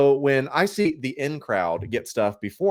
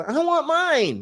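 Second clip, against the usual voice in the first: high-pitched, put-on whine; whiny voice; much slower than usual. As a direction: whine it like an impatient complainer. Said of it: "that's my whiny voice because I'm whining".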